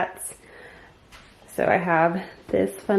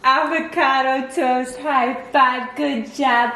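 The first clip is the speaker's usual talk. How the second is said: higher, slow and drawn-out praise; 5 semitones higher, slower than usual. Real, not acted. Mom praising baby's high five, higher slow praise.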